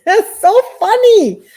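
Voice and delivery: real amused laugh breaking into speech. Real, not acted. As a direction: laugh through the words. Laughing at a viewer comment.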